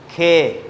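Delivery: खे said loud, with a strong breathy kh; aspirated khe. Shown as a demonstration. Teacher shows kids the letter, aspirated khe. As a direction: punch out खे with a puff. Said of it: "इसे हम पढ़ते हैं".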